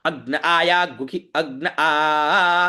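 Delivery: slow mantra chant with a long held final आ; measured recitation of a mantra example; Vedic recitation demo. Shown as a demonstration. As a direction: chant on a level tone, stretching the last vowel. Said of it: "इस प्रकार से".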